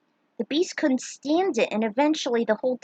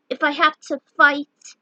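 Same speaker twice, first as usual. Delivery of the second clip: determined; 4 semitones higher, 6 dB louder than usual. Acted. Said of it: "with full determination".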